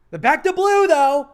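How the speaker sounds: loud, high jab at Back the Blue; emphatic shout. Real, not acted. Calling out Back the Blue supporters, loud pointed jab. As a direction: call them out loudly.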